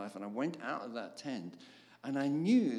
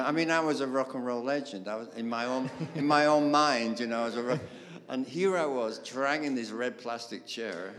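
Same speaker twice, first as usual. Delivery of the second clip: ordinary talk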